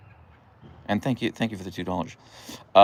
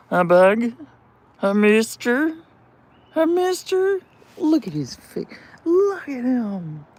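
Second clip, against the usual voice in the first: much higher, squeaky pet voice; 5 dB louder, slower than usual. Real, not acted. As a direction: coo up high at the puppy. Cooing at her dog.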